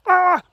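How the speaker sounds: very high, loud "ah!"; sudden high yelp. Real, not acted. Speaker excusing the bumpiness, sudden loud high yelp. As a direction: let out a sharp high cry.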